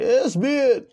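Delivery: high, loud burst of delight; excited shout. Real, not acted. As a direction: yell it out, amazed at the speed. Cheering the running character.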